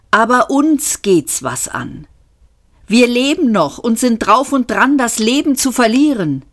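lightly voiced young president, insistent; lightly voiced Seyfort. A narrator's reading. Zaphod pleads with ghost ancestor.

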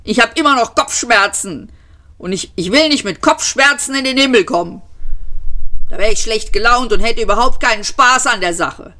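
high, whining complaint; indignant high-pitched outcry; cried protest. A narrator's reading. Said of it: "schrie er".

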